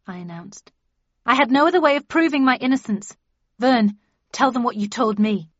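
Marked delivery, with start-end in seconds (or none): 1.2-5.5 urgent, fast pleading. Acted. Accusing Dean before bellman.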